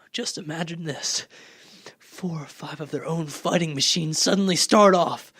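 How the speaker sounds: ordinary talk, nothing marked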